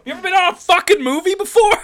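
high, loud mock-outraged actor; hammed-up tantrum at a crew member. Actor berating crew on a film set, mock furious yelling.

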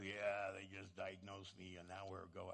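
very low, soft, sickly rasp; covid raspy voice. Retold or imitated. Sick brother answering about his diagnosis, imitated raspy covid voice. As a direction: imitate someone feebly croaking through illness. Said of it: "you have that covid raspy voice".

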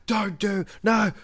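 put-on high squealing teen yelling to back off; panicked mate. Mate fleeing around the house, high panicked squeal. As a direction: shriek while running away.

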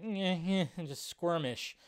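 ordinary talk

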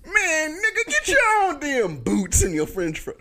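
high, laughing mock Obama; reenacting the French fries line as Obama; mock Obama reenactment. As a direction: ham up the quote with a laugh. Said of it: "[name], can you reenact it?".

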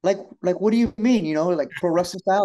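put-on a young wrestler who doesn't get it; confused question. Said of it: "And I said".

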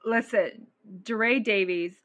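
normal talking voice

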